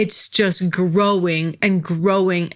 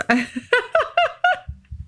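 high laugh. Real, not acted. Laughing at her small follower count.

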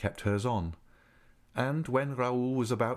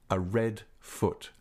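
plain reading voice

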